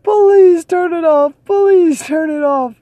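squealing mock plea to make it stop; mock desperate plea. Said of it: "I'm like".

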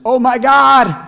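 loud, high, startled yell; panicked cry. Acted. Atheist hunter falling before the diving bear, a panicked loud yell. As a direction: shout it as the bear lunges.

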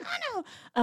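very high, squeaky "oh no"; mock alarm. Recalling her fright in the house, a high squeaky "oh no". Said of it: "I was like".

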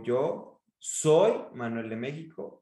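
slow down and punch "soy"; stress on SOY. Shown as a demonstration. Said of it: "subir el tono en una pala".

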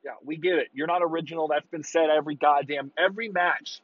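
usual speaking voice

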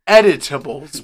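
loud, emphatic declaration. Shown as a demonstration. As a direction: announce it loudly. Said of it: "E D I T A B L E S".

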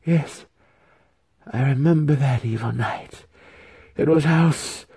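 high-voiced ghostly recollection; remembering spirit. A narrator's reading. Spirit about to name the guilty house, remembering. Spirit recalls the evil night.

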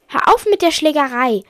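loud, high command; sharp protest. Acted. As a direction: demand loudly that the fight stop. Ordering an end to fighting.

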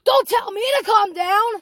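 loud, high imitation of an angry yell; super mad. Yelling customer rebuffs manager's plea, mock-furious. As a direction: shout back as the offended customer. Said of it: "she gets super mad".

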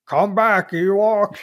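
stiff, phony robotic villain; a hint of a fake mechanical Vader. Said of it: "phony, mechanical sounding voice".

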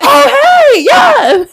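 high loud laughing bouncer reply; mock bouncer. Playing the bouncer answering the drunk girl, laughing loud. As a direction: ham up a bouncer pointing her out. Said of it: "We have somebody recording it".

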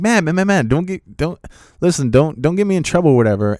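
high-pitched, repeated mock plea; retold worried protest, high and insistent; anxious pleading. Retold plea not to cause trouble.

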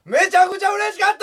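very high, strained gleeful yell; exaggerated big voice. Comedian told to use a bigger voice, exaggerated yell. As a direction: overdo it after being told to speak up. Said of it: "もっと大きい声でお前".

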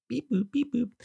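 tiny high-pitched machine noises; high robotic beeps. Retold or imitated. Dad imagining signs that guide him, in high mock-electronic beeps. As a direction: beep and boop like a little gadget. Imitates signage noises after reading sign.

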